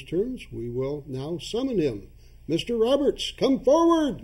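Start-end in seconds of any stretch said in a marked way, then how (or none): 2.5-4.2 loud ceremonial call upward; summoning call. Acted. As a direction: call him forth loudly. Chairman summoning the ghost.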